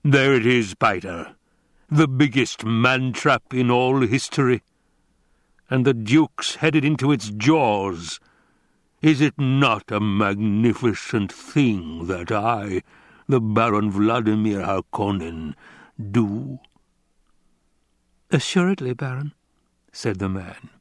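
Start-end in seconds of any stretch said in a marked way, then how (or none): 0.0-16.6 rumbling, gloating villain with slow grand phrases; slow, pompous basso rumble; Baron Vladimir Harkonnen. Acted. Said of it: "A basso voice rumbled out of the chuckle". 18.3-19.3 sweet musical tenor; higher-pitched, lilting courtier's reply; Piter. Acted. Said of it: "His voice came out tenor with a sweet musical quality".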